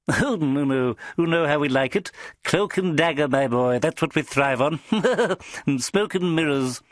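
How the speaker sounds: high, impish little man with a laugh; jolly little boss. A narrator's reading. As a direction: play the tiny spymaster, chuckling. Narrator voices jolly small boss.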